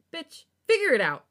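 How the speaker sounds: loud, high scolding yell; exasperated outburst. Real, not acted. Reader scolding the indecisive character, exasperated yell.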